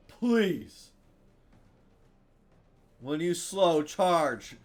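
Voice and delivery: high, loud plea at the game; loud pleading. Real, not acted. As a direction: shout it as an urgent demand. Urging the game for an item.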